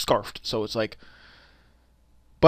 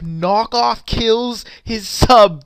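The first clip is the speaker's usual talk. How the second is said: loud, drawn-out disbelief; incredulous emphasis; 7 semitones higher, 7 dB louder, much slower than usual. Real, not acted. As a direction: stretch each word out in amused amazement. Amazed recap of an absurd knockout.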